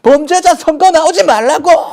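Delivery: full-force high scream, words drawn out; hammed-up furious teacher shout; imitating yelling lecturer. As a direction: bellow it slowly at the top of your voice. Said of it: "소리를 질러요".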